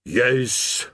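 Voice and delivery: rasping demon's one-word answer; rasped. A narrator's reading. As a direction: rasp it out as a demon. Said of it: "it rasped".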